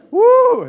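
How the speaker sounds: high, loud whoop; echoing yell. Shown as a demonstration. Showing kids how a yell echoes, loud whoop. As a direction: let out a big whoop to make the room echo. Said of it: "we just yelled in there".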